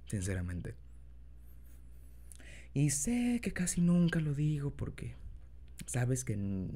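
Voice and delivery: put-on soft, low boyfriend voice; tender, shy lover, quiet; incubus. Confession to partner in role.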